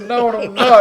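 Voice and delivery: high, loud mock echo; incredulous. Manager echoing the singer's excuse, incredulous shout. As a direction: throw his words back in disbelief. Laughing disbelief at Ricky.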